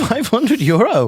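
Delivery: high, loud, drawn-out disbelief; incredulous high-pitched outburst; real shock. Real, not acted. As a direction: repeat the sum slowly in stunned outrage. Shocked at friend's gambling loss.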